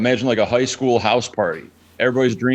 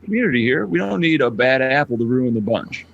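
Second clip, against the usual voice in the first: retold streamer scolding troll; 6 semitones higher than usual. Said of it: "And I'd be like".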